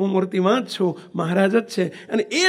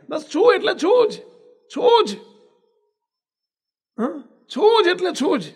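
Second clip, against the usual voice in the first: high, emphatic stress on છું; insistent emphasis; 7 semitones higher than usual. Real, not acted. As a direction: hammer home છું જ, rising high. (છું પર ભાર દઈને ઊંચા સૂરે)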